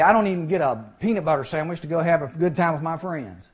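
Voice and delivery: ordinary delivery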